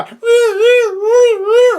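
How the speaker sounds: very high, loud mock wail from under the tree; imitated wail. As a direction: wail like someone pinned down. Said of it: "and I hear a".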